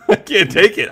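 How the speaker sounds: usual speaking voice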